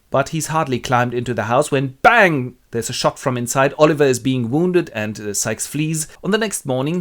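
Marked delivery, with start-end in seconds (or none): 2.0-2.6 loud, higher exclaimed gunshot word; sudden bang. A narrator's reading. Narrator springs a gunshot on listeners, sudden and loud. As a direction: burst out with the shot.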